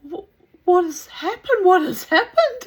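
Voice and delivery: high, loud acted-out alarm; alarmed questioning. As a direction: demand to know, as if mid-panic. Teller acts out her alarmed question.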